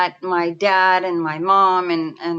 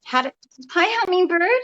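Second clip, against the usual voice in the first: voicing her mom; 10 semitones higher, faster than usual. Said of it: "And she said".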